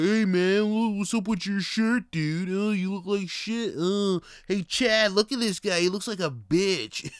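loud, high, jeering frat-bro voice; mocking drunk bro. Mocking drunk bros who pick fights with strangers, loud high jeering voice. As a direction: sneer it like a meathead picking a fight.

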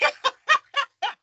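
high, loud laugh; burst of laughter. Real, not acted. Laughing with the interviewer at the memory. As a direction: crack up at the memory. Laughing at her own story.

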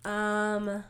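ordinary talk, nothing marked

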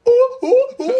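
high, loud monkey ooh-ooh; howler monkey roar. Retold or imitated. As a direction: hoot like a round-faced monkey. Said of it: "and he goes".

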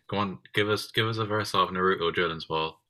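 ordinary talk

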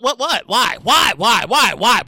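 ordinary talk, nothing marked

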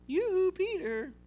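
high, slow, drawn-out call; Jesus turning to look at Peter after the rooster crows. Acted. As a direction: call his name slowly and sorrowfully. The Lord looks at Peter.

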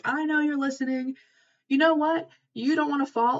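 high, pointed mock challenge; bashing Zeus. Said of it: "he's, like, bashing Zeus".

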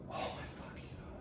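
breathy under-the-breath exasperation; whisper. Real, not acted. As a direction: mutter it to yourself, no voice. Whispered aside about school prison.

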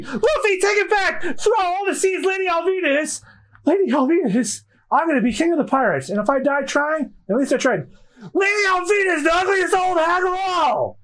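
high, straining yell of defiance; desperate shout. Acted. Timid Kobe finally shouting his defiance at Alvida. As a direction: scream it out with all your courage. Kobe screams insult bravely.

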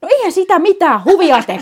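heated protest. Real, not acted. Heated protest about gift.

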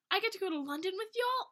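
high, excited yell in reading aloud. A narrator's reading. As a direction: yell it out, thrilled, as a question. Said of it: "I yell and jump onto".

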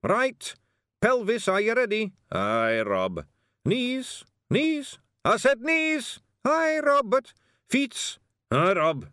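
high, loud Scots back-and-forth; tiny soldiers bickering over knees; squabbling Feegles. Acted. Feegles drilling the disguise.

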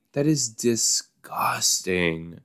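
slow, drawn-out disgust; disgusted groan at the screen. Real, not acted. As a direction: stretch it out, revolted. Revolted by pig eating.

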